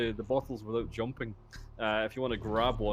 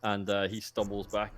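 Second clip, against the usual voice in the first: ordinary talk, nothing marked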